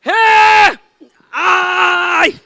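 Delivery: high, loud shouted reply. Shown as a demonstration. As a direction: yell it out like a cheer. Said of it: "देखो, ऐसे". (ऊँची आवाज़ में ज़ोर से चिल्लाना)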